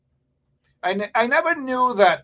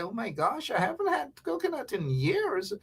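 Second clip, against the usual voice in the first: high, soft gushing over coconut; mock nostalgic gush, pitched up; mock returning visitor; 6 semitones higher, 8 dB quieter than usual. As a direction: imitate a homesick expat swooning. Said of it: "and say".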